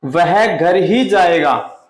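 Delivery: very slow, ही pressed; stress on घर ही. Shown as a demonstration. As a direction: insist on "घर ही". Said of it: "और कहीं नहीं जाएगा".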